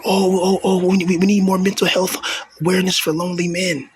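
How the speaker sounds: high, mock-sympathetic whine; mocking whiny voice. Mocking society's fake concern.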